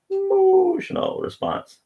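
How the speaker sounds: very high, slow cartoon squeak; squeaky mock voice. Lecturer voicing a picture, very high and slow. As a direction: squeak it out like a toy figure. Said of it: "I don't know why I gave him a high voice".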